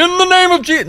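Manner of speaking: high, hammed-up preacher cry; mock faith-healer proclamation. Mocking a showy healer raising the dead, hammed-up cry. Mocking a loud public healing.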